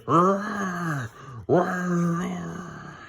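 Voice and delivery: hammed-up monster roaring. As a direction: roar it out like a trapped beast. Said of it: "He roared and screamed".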